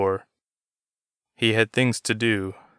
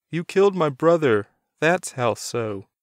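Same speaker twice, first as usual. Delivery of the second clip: anguished accusation; 7 semitones higher than usual. A narrator's reading. Doug accuses Jason of killing his brother, anguished. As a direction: hurl it at him, then drag it out.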